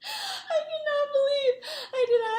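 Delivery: high, loud laugh-crying; laughing through tears. Real, not acted. Vlogger reliving her embarrassment, laughing through tears. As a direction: cringe and laugh till it cracks. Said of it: "laugh cry".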